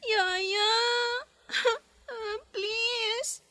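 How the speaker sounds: slow, sobbing begging; crying plea. Acted. Child begs nanny not to leave, sobbing. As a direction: plead through tears.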